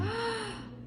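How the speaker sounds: high, soft gasp; delighted gasp. Real, not acted. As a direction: gasp in awe at the food. Sees the cooked corn.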